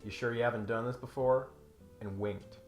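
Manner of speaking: ordinary talk, nothing marked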